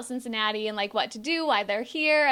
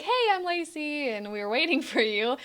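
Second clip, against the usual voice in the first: awkward fan greeting; 3 semitones higher than usual. Pretend. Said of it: "I don't wanna just run up to this guy and be like".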